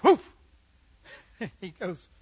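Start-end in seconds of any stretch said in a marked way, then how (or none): 0.0-0.3 short high, loud woof; dog bark. Acted. Dog answering the butcher, barking. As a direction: bark out one sharp woof. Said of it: "Dog puts the purse down and goes".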